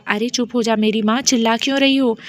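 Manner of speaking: plain reading voice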